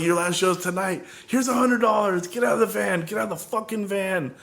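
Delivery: high, strained angry shouting; furious ultimatum. Retold or imitated. Firing the drummer and ordering him out of the van, furious. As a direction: throw him out of the van, pitch climbing in rage.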